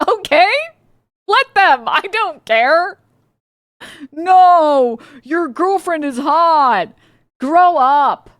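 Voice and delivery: high, loud exasperated rant; loud scornful high outburst; exasperated shouting. Real, not acted. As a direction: yell it at them, fed up. Heated rant at jealous partner.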